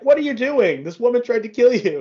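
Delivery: high, mock-alarmed fairy voice. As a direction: squeak a warning like a lightly voiced little fairy. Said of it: "his fairy is like".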